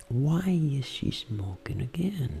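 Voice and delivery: very slow soft whisper; talking to oneself. Shown as a demonstration. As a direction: whisper it slowly, mouthing each word. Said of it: "Falando comigo mesmo". (sussurro lento, falando consigo mesmo)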